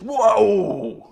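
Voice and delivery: jolted out of a dead sleep; startled awake. Retold or imitated. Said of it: "It's almost like".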